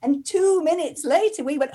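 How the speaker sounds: normal talking voice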